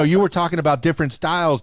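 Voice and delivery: usual speaking voice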